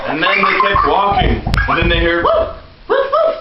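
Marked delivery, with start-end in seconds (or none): 2.2-3.4 much higher yappy woof; high puppy barks. Pretend. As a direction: bark like a little puppy. Dog barks in story.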